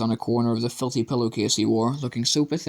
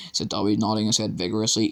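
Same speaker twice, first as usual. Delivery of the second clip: ordinary delivery, nothing marked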